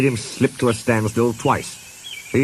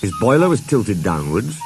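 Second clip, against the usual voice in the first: ordinary delivery, nothing marked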